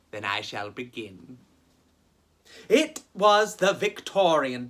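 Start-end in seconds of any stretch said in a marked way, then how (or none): 0.1-1.4 much lower, softer spooky narrator; soft, low ghost-story opener; ominous storyteller. Acted. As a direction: drop into a deep spooky voice to begin.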